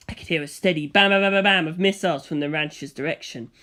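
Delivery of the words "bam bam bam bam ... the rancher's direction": plain reading voice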